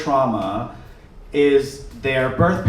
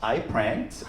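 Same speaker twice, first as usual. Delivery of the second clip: sheepish; 4 semitones lower, 8 dB quieter than usual. Retold or imitated. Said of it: "And I was like".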